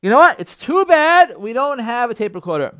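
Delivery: loud, high, outraged disbelief; can't-believe-it outburst, hammed up; indignant disbelief. Husband fuming at his wife's words, loud outraged disbelief. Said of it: "And you're like".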